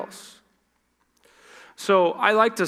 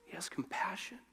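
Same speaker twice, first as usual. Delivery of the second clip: hushed; 17 dB quieter than usual. Real, not acted. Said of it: "soft emphasis".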